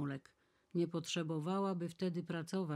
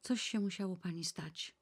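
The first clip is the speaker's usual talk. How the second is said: plain reading voice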